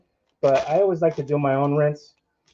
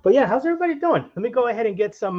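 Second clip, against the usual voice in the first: bright sudden greeting; 9 semitones higher, faster than usual. Real, not acted. Greeting viewers while cooking.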